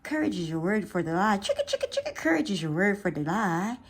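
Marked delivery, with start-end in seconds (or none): 1.4-2.1 sound effect. Pretend.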